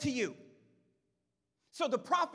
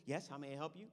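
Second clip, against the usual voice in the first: polite servant; 11 semitones lower, 13 dB quieter, faster than usual. Retold or imitated. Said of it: "He says".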